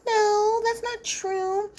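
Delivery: high, drawn-out imitation of a woman objecting; exaggerated high-pitched protest; mock protest. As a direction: draw it out high like a mocking denial. Voices Mallory's whiny protest.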